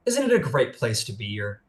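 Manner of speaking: a hint of a cheery, encouraging spirit; lightly voiced guide. Spirit welcoming Trixie to the wilds, bright and encouraging. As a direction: lift up high on wilds, then ease off.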